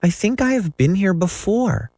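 put-on high, unsure small boy's voice; a little boy wondering aloud; doubtful little boy. A narrator's reading. Michael to John, puzzled in a high child's voice. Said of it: "looking around him doubtfully".

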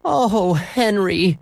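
high, long drawn-out groan of dismay; moaning mother.

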